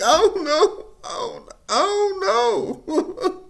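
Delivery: high drawn-out tearful I don't know; half-laughing, half-sobbing helplessness; laughing crying. Retold or imitated. Son answering mom, laughing through tears.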